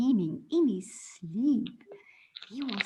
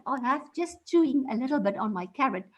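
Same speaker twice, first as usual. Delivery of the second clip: ordinary delivery, nothing marked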